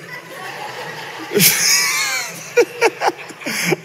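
a big, high-pitched burst of laughter. Real, not acted. Preacher cracking up at grandma's toilet plaque, big laugh.

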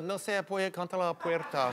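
softly reeled-off Spanish; half-hearted Spanish test answer. Retold or imitated. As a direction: say the Spanish softly, as a stock fallback. Test answer he didn't know.

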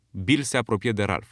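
ordinary delivery, nothing marked

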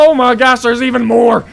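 high, loud, urgent calls; alarmed battle yelling. Real, not acted. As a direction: yell orders as the enemy swarms in. More enemies appear, yells dive.

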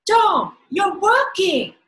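high, loud, drawn-out exclamation; amazed cry, stretching the words; repeated surprise. A narrator's reading. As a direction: shout it slowly in disbelief. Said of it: "Ben repeated".

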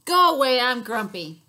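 loud grumpy shout; grumpy yell. Acted. Owl shouting at the friends, loud grumpy shout.

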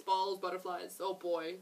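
plain reading voice

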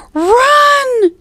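high, loud, drawn-out cry of warning; urgent shout. A narrator's reading. Annie warns Jack to flee the pirates, urgent high shout.

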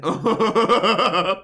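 high, rolling chuckle; laughing. Real, not acted. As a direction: break into a run of laughter. Laughs after singing.

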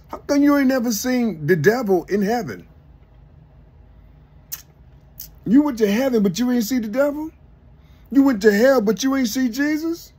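like a preacher hitting full fire, challenging the crowd; building incredulous rant. Real, not acted. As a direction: whip the questions up until you're hollering them. Incredulous rant about heaven and hell.

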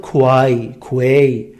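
کوای as "kwai", then کوی as "kwey"; students' mispronunciations. Retold or imitated. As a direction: sound out the written u in کوای and کوی. (تلفظ اشتباه «کوای، کوی» با صدای «و»)

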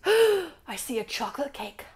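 gasping, amazed discovery; playful wonder; mock gasp of delight. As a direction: gasp at the cake. Shark spots delicious food.

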